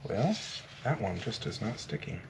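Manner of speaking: talking around a glue pen; mumbled with a pen between teeth; pen in mouth. Real, not acted. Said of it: "I hold the glue pen in my mouth".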